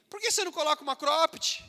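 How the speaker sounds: high, jeering schoolyard taunt, mildly hammed; a light imitation of mocking teens; mocking classmates. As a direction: sneer at the believer's clothes like a teasing kid. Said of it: "Vão começar a chacotear".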